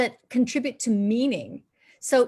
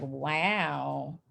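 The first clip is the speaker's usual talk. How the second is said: unimpressed wow; 7 semitones lower, 6 dB quieter than usual. Retold or imitated. Voicing unimpressed people watching the film.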